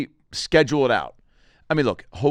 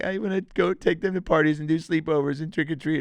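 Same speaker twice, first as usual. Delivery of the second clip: imitating wife, pitched up; imitated wife; 6 semitones higher than usual. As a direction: quote her wishes in a raised, wistful voice. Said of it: "She's like".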